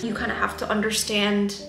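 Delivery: ordinary talk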